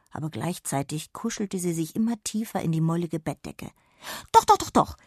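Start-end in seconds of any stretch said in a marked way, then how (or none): 4.3-5.0 very high, loud eager yes; pleading with glee; excited shout. Acted. Jonna insists to the fairy, loud excited shout. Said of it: "rief Jonna vor Begeisterung so laut".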